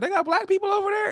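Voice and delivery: high-pitched, amazed question; naive astonishment. Retold or imitated. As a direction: ask it as if truly dumbfounded. Retelling naive surprise at a discovery.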